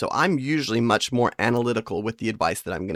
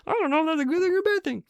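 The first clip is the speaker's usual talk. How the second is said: much higher, fast froggy puppet voice; Kermit the Frog; faster than usual. Retold or imitated. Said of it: "he said it like Kermit the Frog".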